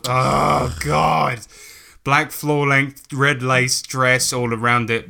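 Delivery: ordinary talk, nothing marked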